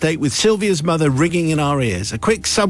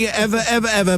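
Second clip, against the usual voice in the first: each "ever" leaned on, rising then falling; emphatic repetition; 5 semitones higher than usual. Real, not acted. Promising the priest never again, each "ever" stressed. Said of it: "never again".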